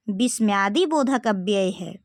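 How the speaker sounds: usual speaking voice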